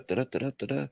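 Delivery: usual speaking voice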